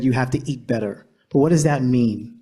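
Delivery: ordinary talk, nothing marked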